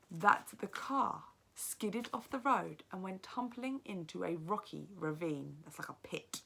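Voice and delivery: lively read-aloud narration, teacher to class; story narration. A narrator's reading. Teacher reads the novel aloud.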